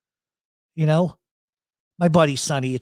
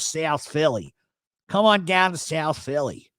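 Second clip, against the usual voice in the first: broad South Philly vowels; drawled South Philly local; South Philly accent. Shown as a demonstration. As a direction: invite them in thick Philly. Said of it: "That's how they talk".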